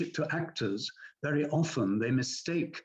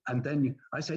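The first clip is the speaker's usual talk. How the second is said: normal talking voice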